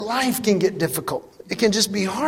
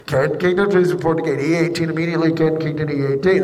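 airport page; 5 semitones lower than usual. Retold or imitated.

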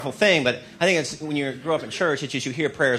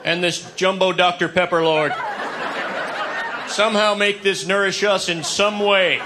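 mock pious prayer; 4 semitones higher than usual.

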